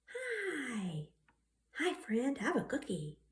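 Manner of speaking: high, soft friendly creature voice; a tiny creature offering a treat; friendly little creature. Acted. A drawn creature greets Brian, soft and high.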